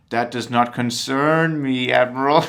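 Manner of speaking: loom over the admiral as Vader. Retold or imitated. Imitating Vader to the admiral, slow and menacing. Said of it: "And Vader goes".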